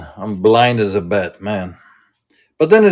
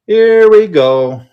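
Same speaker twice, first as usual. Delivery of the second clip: higher, louder, slow drawn-out hype; hype announcer call; 8 semitones higher, 7 dB louder, slower than usual. Real, not acted. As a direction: stretch it out like a live auction kickoff. Hyped repeat as auction goes live.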